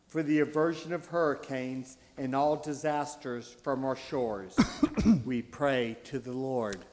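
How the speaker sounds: ordinary talk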